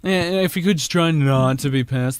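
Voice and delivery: passive-aggressive boss. Pretend.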